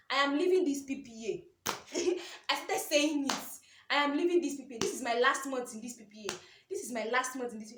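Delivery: bold declaration. Retold or imitated. Telling herself she is leaving the job, loud and bold. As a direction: declare it like a faith confession.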